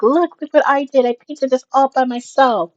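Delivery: put-on high, loud child voice; excited little kid. Kid showing a grown-up what they painted, high excited voice. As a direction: show off like a proud small kid. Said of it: "when a kid comes up to you".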